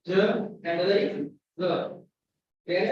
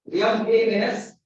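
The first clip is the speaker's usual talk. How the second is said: ordinary delivery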